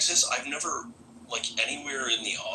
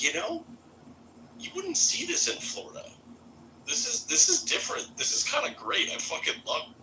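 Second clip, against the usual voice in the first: much higher, delighted mock exclaim; amazed inner voice; 8 semitones higher than usual. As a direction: gush like you just found something great. Quoting own inner amazed thought.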